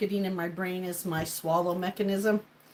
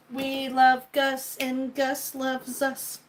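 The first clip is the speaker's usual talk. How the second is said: slow, louder, higher declaration; 4 semitones higher, much slower than usual. Shown as a demonstration. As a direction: stretch each word out, raised and proud.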